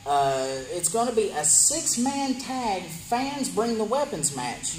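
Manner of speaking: ordinary talk, nothing marked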